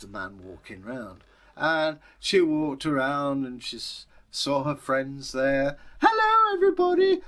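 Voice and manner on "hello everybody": put-on chirpy child calling out hello to everything; little girl. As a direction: falsetto little girl greeting the world. Little girl greeting friends.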